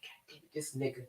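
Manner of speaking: unvoiced, barely audible cursing to oneself; muttered inner curse. Woman retelling falling for a man, muttered curse. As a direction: mutter it under the breath. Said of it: "I'm like".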